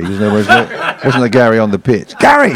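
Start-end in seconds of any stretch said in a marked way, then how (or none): 2.2-2.5 shouting. Retold or imitated. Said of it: "He's going".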